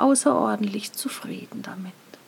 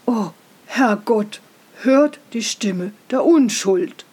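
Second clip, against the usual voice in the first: moved father; 3 semitones lower, 4 dB louder than usual. Acted. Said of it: "sagte der Vater".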